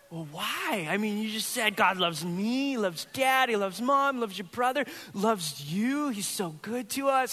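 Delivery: high, hammed-up coaxing; mock pleading. Pastor retelling how he coaxed his daughter, high mock pleading. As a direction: plead with a stubborn little kid.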